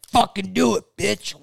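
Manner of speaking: high, taunting dare; retold goading. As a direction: act out egging on an opponent. Brother goading a man acted out.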